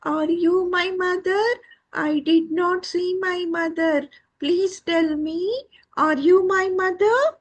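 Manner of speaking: a small chick begging in a high voice; pleading chick. Acted. Lost chick asks an animal for its mother, pleading.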